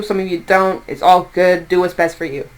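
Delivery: ordinary delivery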